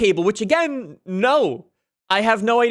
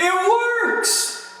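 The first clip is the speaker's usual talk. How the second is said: high, surprised and thrilled; delighted shout; 10 semitones higher than usual. Real, not acted. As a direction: cheer the surprise. Keyboard works.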